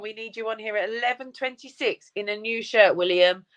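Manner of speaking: normal talking voice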